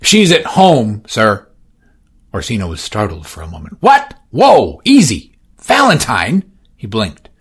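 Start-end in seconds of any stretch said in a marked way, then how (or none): 0.0-1.5 higher, slow dutiful report; voiced assistant. Assistant reporting to Orsino, slowly. 3.8-6.4 high, loud startled yelp; startled cry. Acted. Orsino startled by his assistant's report.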